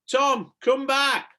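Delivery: high, loud, slow call. A narrator's reading. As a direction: call across the garden. Calling across the garden.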